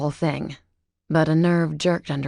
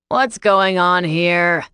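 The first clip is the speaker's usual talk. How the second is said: louder, slow demand; cried out; 6 dB louder, much slower than usual. A narrator's reading. Teacher shouting at the crowd, loud slow demand. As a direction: call out over the crowd. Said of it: "a teacher cried out".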